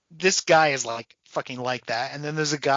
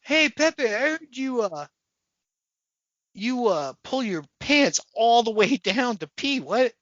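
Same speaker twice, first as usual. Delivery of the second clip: high mocking kid voice; high, teasing cartoon voice; comic character voice; 7 semitones higher than usual. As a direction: tease Pepe in a pitched-up voice. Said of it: "another character's like".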